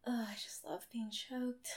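winded, whispering on the breath; barely voiced, catching breath; breathless whisper. Real, not acted. Breathless after being choked.

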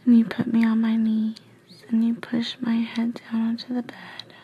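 plain reading voice

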